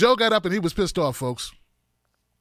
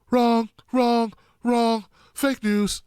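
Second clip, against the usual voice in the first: Trump impression; 2 semitones higher, much slower than usual. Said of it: "[name] turned into, um, Donald Trump".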